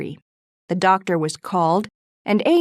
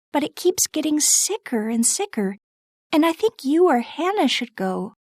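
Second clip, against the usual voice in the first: put-on high, gentle young girl's voice; Beth's voice; 7 semitones higher than usual. Narrator voices Beth.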